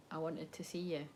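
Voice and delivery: very soft, low-pitched confession; vulnerable. Retold or imitated. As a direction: confess it quietly, barely projecting. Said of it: "It's actually quite vulnerable".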